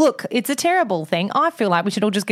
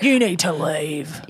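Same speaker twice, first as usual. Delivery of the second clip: mock guard; 5 semitones lower, slower than usual. Mock guard, slow far voice.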